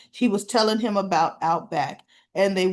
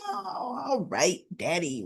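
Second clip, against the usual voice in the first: grudging daughter; 6 dB quieter than usual. Retold or imitated. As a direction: give in to Dad, voice falling away. Retold daughter giving in to dad.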